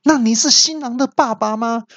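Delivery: high, insistent questioning. Retold or imitated. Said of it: "提高音调问".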